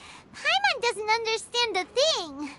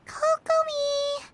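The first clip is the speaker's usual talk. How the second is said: calling out; 5 semitones higher, slower than usual. Real, not acted. Calling to sleeping Kokomi.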